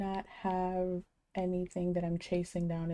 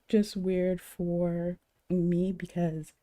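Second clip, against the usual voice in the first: usual speaking voice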